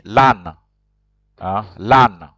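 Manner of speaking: lan said clearly as lahn with a nasal vowel; model to repeat. Shown as a demonstration. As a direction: give lan twice for the class. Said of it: "Repeat after me".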